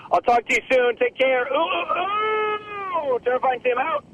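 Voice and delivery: high-pitched sign-off ending in a drawn-out howl. Acted. Terrifying Tim signs off for the hot tub, high and howling. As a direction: sing out goodbye, then a long high "ooh".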